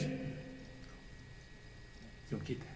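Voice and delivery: slow breathy denial; whispered. Real, not acted. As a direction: let it fall away to a whisper. Quiet final reply.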